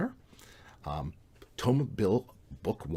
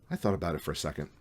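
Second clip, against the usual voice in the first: ordinary delivery, nothing marked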